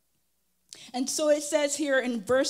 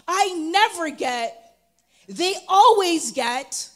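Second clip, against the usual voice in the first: put-on complaining kids; 4 semitones higher, 9 dB louder, slower than usual. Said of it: "I see my kids all the time".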